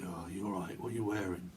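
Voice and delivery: low, soft mock come-on; sleazy. Imitating someone asking what you're wearing, sleazy. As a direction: imitate a sleazy creep, quietly. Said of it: "say things very sleazy".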